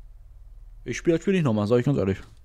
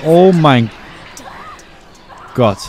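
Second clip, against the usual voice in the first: shocked; 5 semitones higher, 6 dB louder, slower than usual. Real, not acted. Shocked at in-game death.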